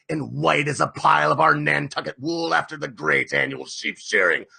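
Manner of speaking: put-on loud, commanding old captain; rally the crew with fire; rousing captain. A narrator's reading.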